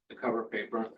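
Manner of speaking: ordinary delivery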